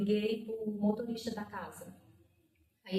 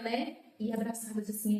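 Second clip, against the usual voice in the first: usual speaking voice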